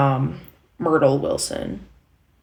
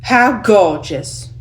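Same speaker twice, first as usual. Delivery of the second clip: slow, raised, loud sarcastic delivery; sarcastic New York stage line; 4 semitones higher, 5 dB louder, much slower than usual. Acted. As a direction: stretch it out with heavy sarcasm. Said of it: "gotta sound sarcastic".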